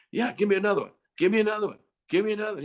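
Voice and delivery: high-pitched, insistent urging; encouraging producer. Retold or imitated. Producer pushing the singer for another take, urgent encouragement. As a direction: imitate a producer egging someone on. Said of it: "then he starts encouraging you".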